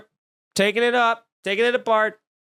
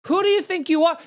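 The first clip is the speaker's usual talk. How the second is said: outraged; 9 semitones higher, faster than usual. Real, not acted. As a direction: call out their nerve. Ranting at the restaurant.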